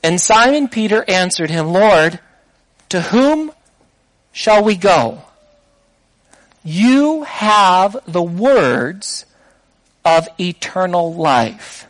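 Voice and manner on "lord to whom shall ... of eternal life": slow reverent confession. Acted. Peter's reply, drawn out.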